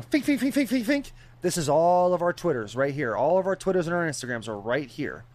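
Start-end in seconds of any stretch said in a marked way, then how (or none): 0.0-1.1 quick, high-pitched repeated sound effect; rapid squeaky little pops for on-screen graphics; imitated pop-up noise. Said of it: "production crew is going".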